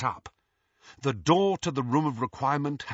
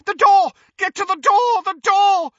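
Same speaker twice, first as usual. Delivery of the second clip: panicked scream, much higher and louder; 9 dB louder than usual. A narrator's reading. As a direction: scream it right into Harry's ear. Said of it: "screamed Malfoy in Harry's ear".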